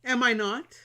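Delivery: high, loud coy flower; sweetly vain. Acted. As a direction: preen sweetly. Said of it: "the flower responded, sweetly".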